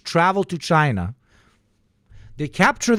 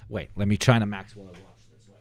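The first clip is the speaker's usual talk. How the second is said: ordinary talk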